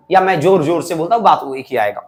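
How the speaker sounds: normal talking voice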